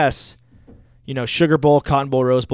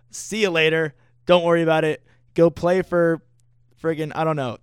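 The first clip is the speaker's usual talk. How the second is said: usual speaking voice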